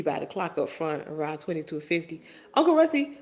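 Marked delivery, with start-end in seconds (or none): 2.5-3.2 pitched-up indignant protest; high protest. Real, not acted. Streamer protesting her uncle's comment, high and disbelieving. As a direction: call him out in disbelief, up high.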